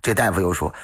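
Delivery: ordinary delivery, nothing marked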